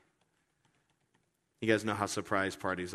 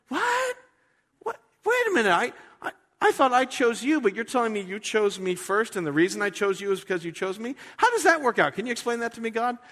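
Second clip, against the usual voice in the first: much higher, loud confused questioning; baffled believer; 11 semitones higher, 5 dB louder than usual. Retold or imitated. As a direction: sputter the questions in disbelief. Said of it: "and we're going".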